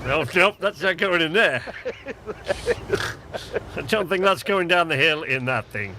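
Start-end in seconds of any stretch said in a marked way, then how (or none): 1.7-3.7 high breathy giggle; high laughter. Real, not acted. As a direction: laugh helplessly. Laughing at the load.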